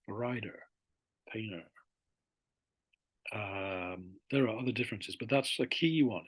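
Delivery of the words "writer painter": RIDER and PAINTER with the T softened or gone; American dropped T. Shown as a demonstration. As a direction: soften each T, American-style. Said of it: "it's kind of dropped the T".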